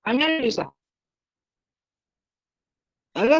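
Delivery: normal talking voice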